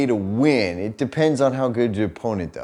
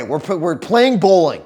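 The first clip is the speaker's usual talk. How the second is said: laughing; 7 semitones higher, 3 dB louder than usual. Retold or imitated. Laughing through the quote.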